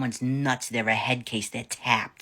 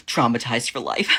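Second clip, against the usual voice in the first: mock-horrified verdict with a touch of drama; lightly hammed-up shocked gossip; scandalized society lady; 3 dB louder than usual. Upper-class woman finishing her gossip to Deborah, mock-traumatized.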